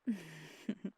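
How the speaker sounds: breathy, falling chuckle. Acted. Flirting with partner.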